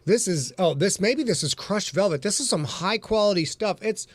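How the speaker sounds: lilting home-shopping presenter patter; hammed-up shopping channel pitch; QVC host. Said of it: "welcome to the Q V C channel".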